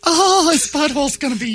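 high, gushing voice; hammed-up high-pitched admirer; mock swooning fan. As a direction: swoon over him way up high. Mimicking women swooning over Clooney.

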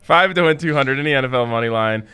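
ordinary talk

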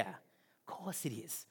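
soft, slow whisper; whispered aside. Real, not acted. Preacher whispering a knowing aside to the congregation. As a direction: let it slip out under the breath.

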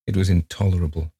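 usual speaking voice

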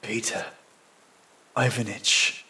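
soft, weak murmur; whispering. A narrator's reading. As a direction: say it faintly, with a long gap between names. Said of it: "I whisper".

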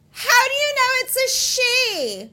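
very high, drawn-out holler; mock yell back. Woman yells back at the hiker's warning, imitated high holler. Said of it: "this woman yells back".

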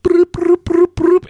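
high loud beeping noise; imitated alarm beeps. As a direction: mimic the radio ringing. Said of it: "And then it goes".

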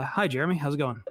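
ordinary talk

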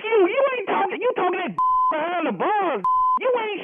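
very high-pitched, indignant retort; angry scoffing. Real, not acted. Husband mocks caller behind bars, indignant. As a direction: scoff at him, voice way up.

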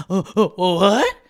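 sudden loud startled "oh, oh, oh, what?"; startled disbelief. Retold or imitated. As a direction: caught off guard, voice jumping up. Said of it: "I would just be like".

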